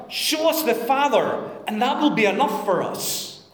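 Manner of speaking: solemn Bible reading, projected; scripture reading. Acted. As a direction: read it out to the congregation with weight.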